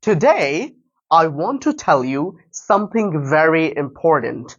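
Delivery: very slow, word by word; slow shadowing. Shown as a demonstration. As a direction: copy the slow podcast pace. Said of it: "ঠিক সেভাবে".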